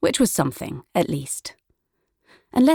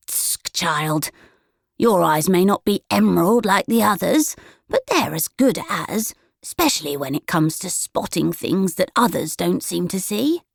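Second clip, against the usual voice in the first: put-on old granny voice, tutting then knowing and sly; a batty old granny sharing a secret with a grin; sly grandmother; 2 semitones higher than usual. A narrator's reading. Granny reassuring Willow, sly knowing old voice. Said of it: "she said with a sly grin".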